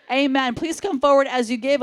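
ordinary delivery

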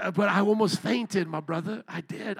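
normal talking voice

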